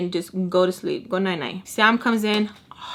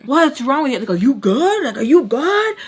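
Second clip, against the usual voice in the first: louder, higher mock-angry demand; imitating super mad; 4 semitones higher, 5 dB louder than usual. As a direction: act him out confronting her, voice raised. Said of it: "super mad".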